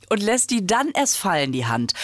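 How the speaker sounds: usual speaking voice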